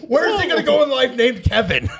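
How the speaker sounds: high, laughing incredulous question; real laughing. Real, not acted. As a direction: ask it while breaking into laughter. Joking amid group laughter.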